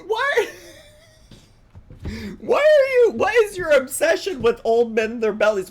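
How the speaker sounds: high, loud, exasperated rant; incredulous yelling. Real, not acted. Berating Tim for watching men at the mall, yelling. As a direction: yell the questions in escalating disbelief. Ranting at man in clip.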